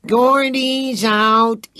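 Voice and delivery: a drawn-out, high, loud announcement; calling out. Acted. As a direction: slowly crow it across the clubhouse. Card game, boy calls out.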